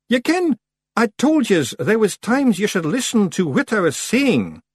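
high, pointed Scottish scolding; icily patient Rob Anybody. A narrator's reading. Rob reminding Daft Willie to listen, pointed reproof. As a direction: spell it out with strained patience. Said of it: "with icy patience".

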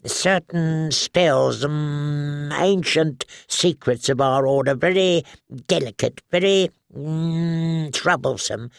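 a jittery little man stammering excuses; nervous alchemist. Acted. Halin the nervous pyromancer.

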